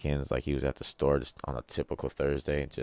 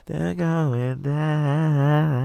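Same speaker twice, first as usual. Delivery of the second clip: very high, loud, slow cartoon voice; hammed-up high mocking drawl; 13 semitones higher, 5 dB louder, much slower than usual. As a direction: stretch it out like a taunting cartoon character. Mock dramatic announcement of falling IQ.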